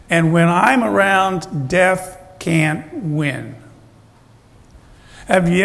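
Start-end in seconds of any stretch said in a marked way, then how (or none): 0.1-3.5 slowed right down, leaning on every word; slow emphatic promise. Acted. Preacher voicing Jesus to mourners, slow and firm. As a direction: let each word land slowly and firmly.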